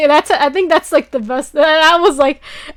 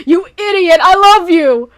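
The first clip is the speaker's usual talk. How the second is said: mock shouted, loud and higher; hammed-up yell of a confession; the hero shouting his love at the girl; 5 semitones higher, 7 dB louder than usual. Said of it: "grab her by the shoulders and go".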